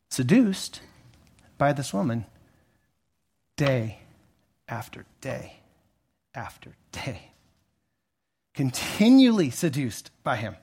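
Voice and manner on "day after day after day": day after day after day with long pauses, fading softer; slow hushed repetition. Real, not acted. Preacher dwelling on the endless temptation, slow and hushed. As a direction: space out each day and let it sink.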